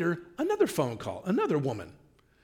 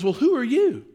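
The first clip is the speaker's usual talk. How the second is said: louder, higher imitation of an affronted caller; challenging demand; 8 semitones higher, 6 dB louder than usual. Man on the line to the wife, challenging. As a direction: throw the question at them as a challenge.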